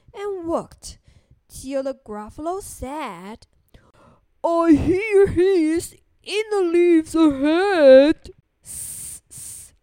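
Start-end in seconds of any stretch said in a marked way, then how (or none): 4.4-8.3 loud, slow monster voice; big Gruffalo. Acted. Gruffalo hears something in the leaves, booming slowly. 8.6-9.7 soft, drawn-out hissing; snake hiss. Acted. Storyteller imitates Snake for children, hissing softly.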